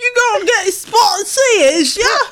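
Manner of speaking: high, loud, slow mock English; annoying English accent. Neighbors teasing traveler bound for England, imitated bad English accent. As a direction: drag it out in a squeaky fake English accent. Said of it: "got an annoyingly English accent back".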